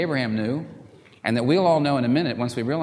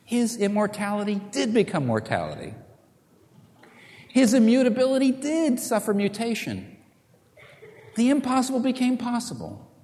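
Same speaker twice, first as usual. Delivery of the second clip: soaring climax; 9 semitones higher than usual. Real, not acted. Preacher's rising climax.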